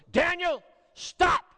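loud, high shout of command; urgent, full-voiced parental shout; stern scream. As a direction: yell it sternly, like a father halting his child. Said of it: "screamed in the sternest and loudest voice".

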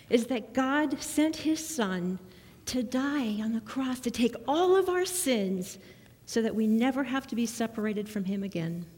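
normal talking voice

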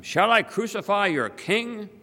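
high-pitched, incredulous appeal; challenging question. A narrator's reading. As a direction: put it to the crowd as a challenge. Pilate asks the crowd.

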